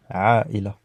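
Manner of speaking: "Aïla" with a clean stop between the vowels; glottal break. Shown as a demonstration. Teacher demonstrating the glottal stop, clear break. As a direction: cut "Aïla" with a catch in the throat. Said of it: "elle marque une pause".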